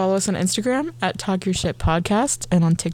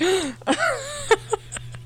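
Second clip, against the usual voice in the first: sharp gasp; 6 dB quieter than usual. Retold or imitated. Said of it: "I was like".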